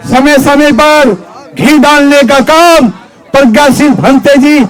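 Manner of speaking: normal talking voice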